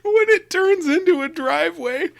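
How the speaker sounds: laughing through the punchline, high. Real, not acted. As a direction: giggle out the answer.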